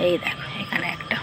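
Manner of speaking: breathy whisper of এই দেখো, এখানে একটা. Real, not acted. Pointing out a bud to viewers in a hushed whisper. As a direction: drop to a secret whisper. Showing hibiscus buds on the roof.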